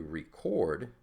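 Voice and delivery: "record" said slowly as a verb, reCORD; stress on second syllable. Shown as a demonstration. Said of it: "if we shift that accent".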